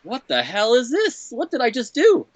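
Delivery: high, slow baffled self-quote; a hammed-up what did I just do; retold disbelief. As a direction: play your own stunned confusion, slowly and high. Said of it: "and I was like".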